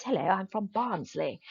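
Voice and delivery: imitating a posh, unregional Barnsley accent; untraditional Barnsley accent. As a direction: do the Barnsley man whose accent barely sounds Barnsley. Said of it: "but he sounded like this".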